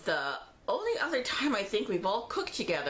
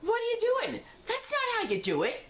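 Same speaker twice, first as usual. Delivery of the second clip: high, indignant family member; indignant relative. Retold or imitated. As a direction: act out the scolding aunt. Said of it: "somebody said".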